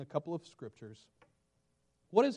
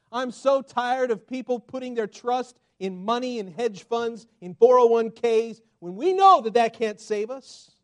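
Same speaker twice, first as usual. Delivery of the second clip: fervent preaching; 5 semitones higher, 10 dB louder than usual. Real, not acted. Pastor railing against trust in money, fervent preaching. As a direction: drive the point home, rising.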